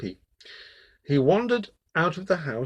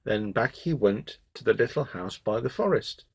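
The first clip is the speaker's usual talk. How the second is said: plain reading voice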